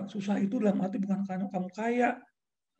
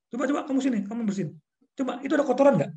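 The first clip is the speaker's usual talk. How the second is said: high, raised scolding of staff; angry boss; 8 semitones higher, 4 dB louder, faster than usual. Retold or imitated. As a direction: call the worker over and scold. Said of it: "Kadang saya suka marah".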